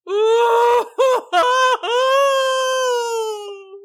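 high, drawn-out wailing howl; loud "ooooh" wail, held long; long troll bellow. A narrator's reading. Troll screaming under the bridge, long high bellow. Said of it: "Susan heard".

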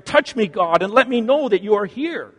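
man crying out to God in despair. A narrator's reading. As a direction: cry out in despair. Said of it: "cries out in despair".

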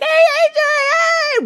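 very high, loud happy call; cheerful greeting call. Retold or imitated. Coworkers across town greeting him with a loud call. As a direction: call out his name across the room. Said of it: "they're like".

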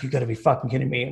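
rattled off fast in exasperation; fast exasperated. Retold or imitated. Two in the morning, phone dying, fast exasperated rant. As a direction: spit it out quickly, fed up. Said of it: "I'm like".

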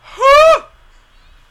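sudden shrill gasp, very high and loud; high squeaky gasp. Real, not acted. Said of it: "hit an [name] note".

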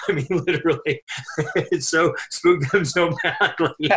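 real laughter breaking up the words, pitched high; laughing. Real, not acted. Recalling how badly they were spooked, laughing hard. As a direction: talk through helpless laughter. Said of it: "I'm laughing so hard".

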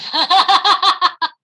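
high, loud laugh; real burst of laughter. Real, not acted. Laughing hard at the skin tags joke. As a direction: crack up at the joke.

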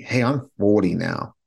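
usual speaking voice

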